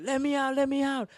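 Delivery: high, sustained cry for escape; panicked plea. Acted. Trapped man trying to get out, acted panic. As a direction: beg frantically to be released.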